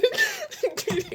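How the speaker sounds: a very high laugh breaking toward tears; teary laugh. Real, not acted. Telling viewers how proud she is, teary laugh. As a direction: laugh through tears of pride. Emotional, almost crying.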